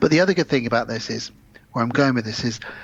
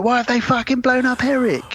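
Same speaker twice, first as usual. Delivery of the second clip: mock outraged cry; 10 semitones higher than usual. Mimicking Bob Hoskins' outrage.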